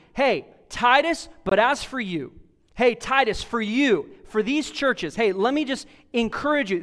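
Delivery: acted urgent charge to a younger colleague, high and loud; table-smashing emphasis. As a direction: hammer it home as if pounding a table. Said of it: "you sitting down at a table and smashing".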